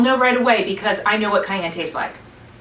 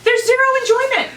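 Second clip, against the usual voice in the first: high excited complaining; 10 semitones higher than usual. Real, not acted. Reacting to hot pepper.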